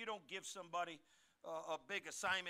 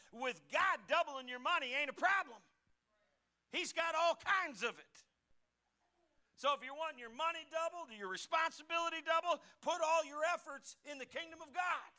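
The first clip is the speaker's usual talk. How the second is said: higher, louder, fervent climax; preaching at full force; 10 semitones higher, 9 dB louder than usual. Acted. Pastor urging the church toward the kingdom, fervent. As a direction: rise up and exhort the church.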